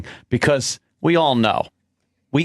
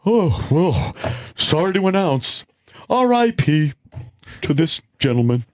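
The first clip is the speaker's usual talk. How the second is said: mock-sorry death announcer, pitched up in places; mock Earl announcing deaths. Host mocking Earl's online R I P posts, mock-mournful announcement. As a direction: imitate a solemn obituary poster, halting between phrases. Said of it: "That's his".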